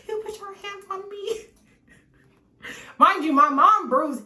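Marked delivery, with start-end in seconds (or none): 0.1-1.5 mock outraged mom. Mom's outraged accusation retold.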